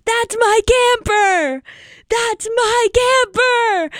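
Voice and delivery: high, slow, drawn-out proud cheer; imitating a proud camp counselor yelling; mock screaming. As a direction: cheer for the kid from the front row. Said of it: "so I was just screaming".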